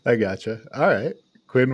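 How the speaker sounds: ordinary talk, nothing marked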